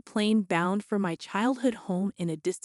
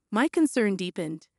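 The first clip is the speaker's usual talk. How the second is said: usual speaking voice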